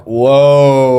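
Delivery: loud, drawn-out wow of astonishment; amazed whoa. Retold or imitated. Friend retelling his reaction to the video, amazed. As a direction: let it swell, long and loud, in re-enacted amazement.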